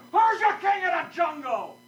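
a cocky lion demanding an answer, pitched high; bossy lion. Acted. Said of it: "he says to the squirrel".

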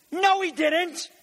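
high, insistent no; emphatic correction. Real, not acted. Preacher correcting the English to the congregation, emphatic. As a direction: cut in hard to correct the text.

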